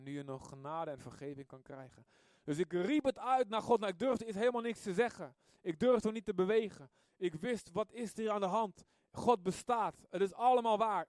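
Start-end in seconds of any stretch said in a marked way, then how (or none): 2.4-11.1 loud, high testimony, driving each line; preaching at full force. Acted. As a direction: proclaim it like a preacher in full flow. (luide, hoge prediking)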